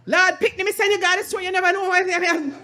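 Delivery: high, fast patois tirade; Jamaican patois outburst. Retold or imitated. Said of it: "and then the patois came out".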